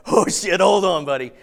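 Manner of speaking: gleeful anticipation. Retold or imitated. Gleeful aside to buddy.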